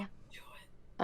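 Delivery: breathy unvoiced warning whisper.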